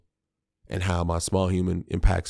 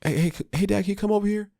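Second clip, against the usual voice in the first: put-on much higher, eager child's voice; kid calling dad; 13 semitones higher, faster than usual. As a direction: call out to dad like a little kid wanting attention. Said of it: "I've made that a part of".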